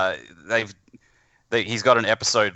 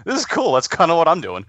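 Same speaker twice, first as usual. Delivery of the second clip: excited; 9 semitones higher, 4 dB louder than usual. Retold or imitated. Excited self-quote about radio drama.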